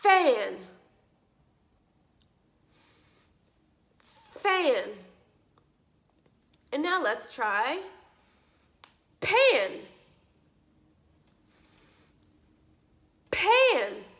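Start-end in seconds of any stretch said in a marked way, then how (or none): 0.0-4.8 "Fan" loud and separate, starting on a clear F; F sound demo. Shown as a demonstration. Teacher drilling fan against pan, F sound demo. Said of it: "say the word fan". 9.2-14.0 "pan" higher and louder, with a popped p; P sound demo. Shown as a demonstration. Teacher drilling pan against fan, P sound demo. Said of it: "gonna say the word pan".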